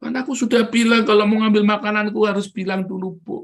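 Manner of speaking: put-on high, loud childish reproach; imitated child insisting on the rule; protesting small child. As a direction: scold the grown-up like an indignant toddler. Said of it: "dia belajar menerapkan itu".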